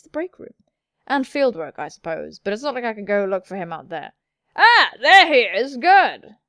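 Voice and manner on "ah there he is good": loud, high, drawn-out exclamation; hammed-up cry of relief; exaggerated discovery. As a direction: call out on spotting someone. Loudly acts out spotting Vincent.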